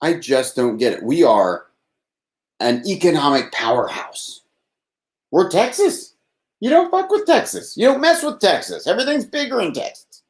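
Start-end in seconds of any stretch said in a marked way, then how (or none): 5.3-10.2 higher, belted Texas-pride slogans; mock Texas pride. Host hamming up Texas slogans to co-hosts, much higher, belted. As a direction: puff up with state pride until you run out of breath. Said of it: "My voice. Oh my God. I ran out of breath".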